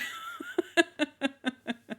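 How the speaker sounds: a high, soft trailing laugh. Real, not acted. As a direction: let the giggle fade out airily. Laughing after retelling her reaction.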